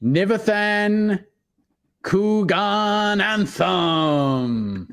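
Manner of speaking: drawn-out ring announcer introduction. Acted. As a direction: stretch the name out slowly like announcing a fighter. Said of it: "say it like a ring announcer".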